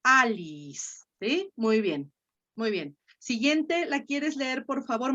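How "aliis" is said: Alice drawn out as A-li-is, stressed on A. Shown as a demonstration. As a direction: repeat Alice slowly, leaning on the opening A. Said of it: "se va hasta la A". (Alice alargado, con el acento en la A)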